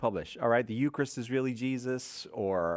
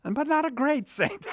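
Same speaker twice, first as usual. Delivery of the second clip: high, put-on comic Italian priest voice; Guido Sarducci impression; 8 semitones higher than usual. Host quoting Sarducci on Saint Patrick in a comic priest voice. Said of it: "He, he says about Saint Patrick".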